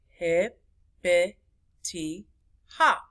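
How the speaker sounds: four separate beats in hippity hop, long gaps between; syllable by syllable. Shown as a demonstration. Teacher counting syllables, hippity hop broken up. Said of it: "That's four syllables".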